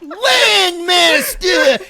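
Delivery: very slow, drawn-out Landmaster yelled high; Falco's Landmaster. Retold or imitated. Co-caster imitates Falco yelling Landmaster with New York accent. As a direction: stretch Landmaster out as one long yell, with master said as mastuh. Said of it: "He doesn't even say master".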